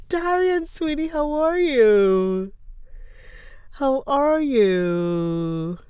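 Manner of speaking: high, slow, sing-song hello; drawn-out loving how are you; sweet greeting. Real, not acted. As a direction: greet a dear friend slowly and sweetly. Warmly greeting a chat viewer.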